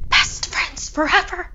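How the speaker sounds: eerie high ghost voice. Acted. Final eerie repetition.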